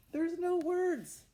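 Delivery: high, loud and slow for emphasis; emphatic exclaim. Acted. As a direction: make the surprise big for the kids. Reading wordless book to children.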